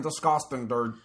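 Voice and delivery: Philadelphia accent. Shown as a demonstration. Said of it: "That's how they talk".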